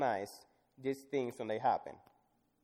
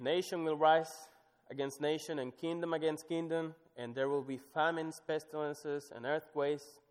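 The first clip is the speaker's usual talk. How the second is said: raised, emphatic reading; scripture reading; 4 semitones higher than usual. Acted. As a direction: proclaim the list. Reads scripture aloud.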